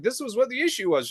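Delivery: parroting back; mocking tone.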